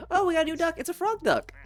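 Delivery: high, bright delight; excited. Real, not acted. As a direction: squeal at the new duck. Finding a cute new toy.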